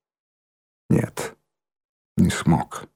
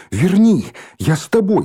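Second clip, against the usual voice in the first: high, louder plea; raised, insistent cry; urgent calling out; 7 semitones higher, 3 dB louder than usual. A narrator's reading. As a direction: call after a friend urgently. Girl calling after departing friend.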